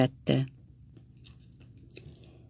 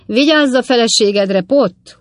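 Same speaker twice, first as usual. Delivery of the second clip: loud, high mocking roar in another voice; jeering shout at Pott; roared warning; 7 semitones higher, 6 dB louder than usual. A narrator's reading. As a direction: bellow it across the crowd. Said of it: "ordította az ötödik".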